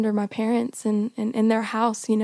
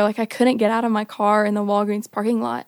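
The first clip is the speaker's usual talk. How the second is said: usual speaking voice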